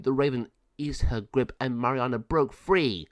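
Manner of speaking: ordinary delivery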